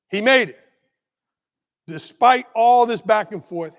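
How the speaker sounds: ordinary talk, nothing marked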